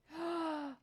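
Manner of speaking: sudden shocked gasp; sharp gasp. Acted. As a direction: gasp in surprise. Shocked reaction to last photo.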